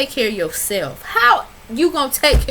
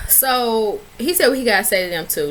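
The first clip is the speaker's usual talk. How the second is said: normal talking voice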